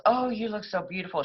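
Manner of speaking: mock gushing, pitched high; high-pitched, lightly acted admiration; gushing hairdresser. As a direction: imitate a hairdresser cooing over her client. Hairdresser gushing over the finished hairdo.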